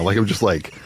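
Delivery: normal talking voice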